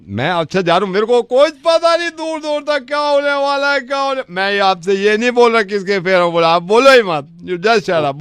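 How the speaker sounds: ordinary talk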